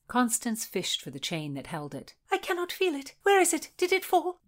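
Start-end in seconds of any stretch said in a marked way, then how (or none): 2.3-4.4 high, rising worried questions; panicked. A narrator's reading. Constance frets, searching for the key, high. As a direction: fret over the lost key.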